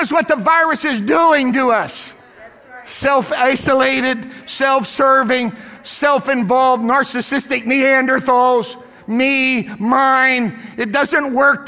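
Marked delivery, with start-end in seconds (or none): 2.8-8.8 each word slow and punched; slow emphatic list. Real, not acted. Preacher scolding congregation, slow and emphatic. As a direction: hammer every word of the list.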